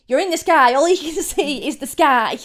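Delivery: annoyed. Retold or imitated. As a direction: snap back, losing patience. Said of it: "a little bit annoyed about it".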